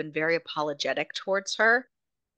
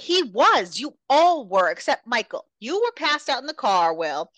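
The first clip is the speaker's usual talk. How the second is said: heated accusation; 7 semitones higher, 4 dB louder than usual. Retold or imitated. Rika accusing the group.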